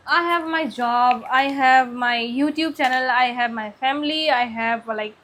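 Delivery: I, have, my, each word drawn out very slowly; very slow deliberate listing. Real, not acted. Telling viewers what she has, very slow and deliberate. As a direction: savour every item, one slow word at a time.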